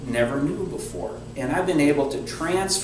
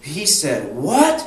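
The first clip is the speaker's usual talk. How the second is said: slow, louder, higher disbelieving question; shocked mother; 5 semitones higher, 5 dB louder, much slower than usual. Retold or imitated. The mother hearing what her son said, in a slow shocked outcry. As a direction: stretch out the disbelief. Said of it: "the lady on the other end of the phone says".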